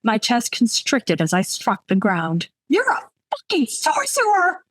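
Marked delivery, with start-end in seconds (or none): 2.7-4.6 high-pitched startled yell, no added volume. A narrator's reading. Startled woman on the floor accusing the intruder, high yell. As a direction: cry it out as a shrieked accusation. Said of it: "I yelled".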